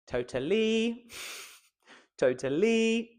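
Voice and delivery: "totally" with LY high and held; stress on LY. Shown as a demonstration. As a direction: misplace the stress, to-ta-LY. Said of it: "or try all three".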